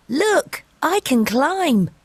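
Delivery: ordinary talk, nothing marked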